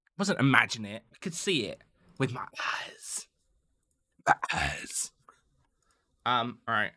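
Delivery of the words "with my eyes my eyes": rasping whisper, drawn out for effect; hushed, voiceless and spooky; creepy whisper. Pretend. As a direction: whisper it like a mock-spooky secret.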